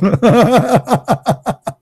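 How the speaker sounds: high burst of real laughter. Real, not acted. Voter laughing at the poll lady's reaction, high real laugh. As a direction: laugh hard at your own punchline.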